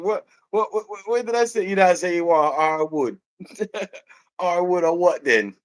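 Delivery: high, stammering Jamaican comeback; Jamaican accent. Retold or imitated. Misunderstanding over hardwood, high Jamaican protest. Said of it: "the Jamaican goes like".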